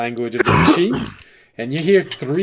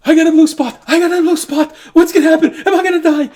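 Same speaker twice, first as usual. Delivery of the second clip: very high, loud, panicked shrieking; screaming; 11 dB louder, faster than usual. Acted. Gnome seeing a blue spot, terrified screaming. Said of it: "just start screaming".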